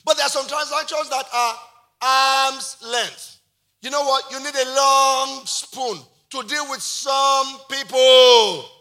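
high, loud, stretched-out preacher's delivery; full-force preaching. Real, not acted. Preacher warning congregation about dangerous people, full-force preaching. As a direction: drive each phrase home, drawing out key words.

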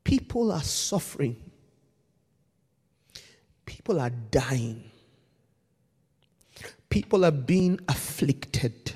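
low, soft and grave; solemn quiet. Real, not acted. Preacher warning Tony about spiritual leaders, hushed and grave. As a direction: drop right down and speak gravely.

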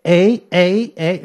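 high mocking eh, eh, eh; mocking Canadian eh. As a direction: tease them with a sing-song eh. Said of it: "they were all like".

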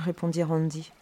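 usual speaking voice

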